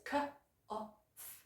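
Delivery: K, O, F as slow, separate sounds; sounded out. Shown as a demonstration. Teacher breaks a word down for learners, sounded out slowly. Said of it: "with an F sound at the end".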